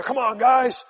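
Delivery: rally the team like a coach in the last quarter; fired-up coach. Retold or imitated. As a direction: coach firing up his players in the final quarter, loud and urgent. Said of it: "the coach will get the guys together".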